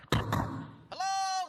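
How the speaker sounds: high, tentative mock call; calling for service. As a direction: call up at the order window. Calling out at taco truck window.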